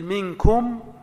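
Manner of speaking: منكم said as minkumu, linking the mim with a damma; minkumu, with a short u before the next word; ميم الجمع بالضم; Arabic pronunciation demo. Shown as a demonstration. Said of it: "نقول منكم الخير".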